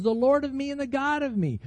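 high-pitched emphatic restatement; emphatic retold declaration. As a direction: voice Thomas's confession with the pitch lifted. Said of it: "He emphatically says".

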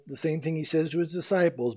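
ordinary talk, nothing marked

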